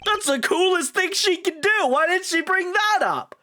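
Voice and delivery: very high, excited squeal; squeaky, stretched-out outburst; amazed girl assistant. Acted. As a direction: exclaim in awestruck disbelief.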